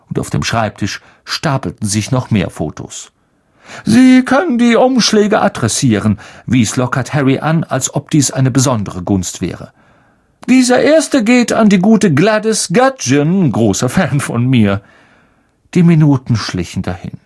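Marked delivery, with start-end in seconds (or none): 3.8-6.2 high, loud vain celebrity voice; Lockhart. Acted. Lockhart hands Harry a chore, high and pompous. 10.5-14.8 high, loud vain celebrity voice; Lockhart. Acted. Lockhart hands Harry a chore, high and pompous.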